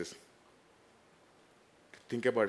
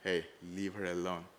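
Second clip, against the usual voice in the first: quiet command to death; 4 semitones lower, 7 dB quieter than usual. Retold or imitated. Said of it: "He says to death".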